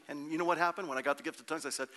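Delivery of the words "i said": usual speaking voice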